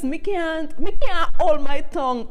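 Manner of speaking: rising from emphatic to loud; building to a shout. Real, not acted. Bursting out about patois.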